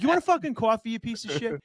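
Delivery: a high, hammed-up furious offer of coffee; mock angry offer. Offering the man a coffee in mock rage. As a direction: spit out the offer like an insult, then drop off. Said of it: "I angrily offer something".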